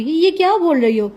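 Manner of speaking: a shocked aunt, voice jumping up; surprised. A narrator's reading. Aunt hears shocking news from niece, startled question. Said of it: "وہ حیرت سے بولی".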